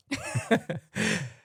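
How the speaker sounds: short high burst of laughter. Real, not acted. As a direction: laugh at your own joke. Laughs at own accent joke.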